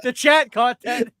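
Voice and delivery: high laughing call to the chat; laughing, calling out to the chat about the slip. Real, not acted.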